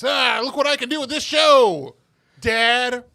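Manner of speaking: gloating. Pretend. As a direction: rub it in with a smug crow. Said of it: "rub it in my dad's face".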